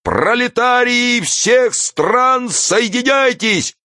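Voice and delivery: high-pitched, slow oratory with drawn-out words; stretched, ringing public speech; impassioned street orator. A narrator's reading. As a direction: harangue the crowd at a high pitch.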